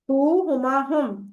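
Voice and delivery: each pronoun spaced out slowly; slow recitation. Shown as a demonstration. Teacher drilling Arabic pronouns for students, slowly. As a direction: lay out the three words slowly like a drill. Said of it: "پھر زیادہ انگلیاں ہم".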